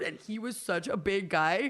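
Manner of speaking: normal talking voice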